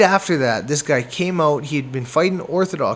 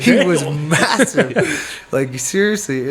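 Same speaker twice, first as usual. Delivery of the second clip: laughing, word drawn out slow and louder; amused amazement; 4 dB louder, slower than usual. Real, not acted. Boxer recalls his huge opponent, laughing in disbelief. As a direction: stretch it out through a laugh.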